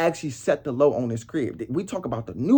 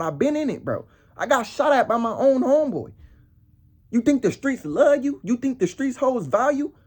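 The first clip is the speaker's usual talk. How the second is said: put-on higher, louder preaching at the young ones; street sermon; 7 semitones higher, 3 dB louder than usual. Warning young guys off street life, raised preaching voice. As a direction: lecture them hard about the streets.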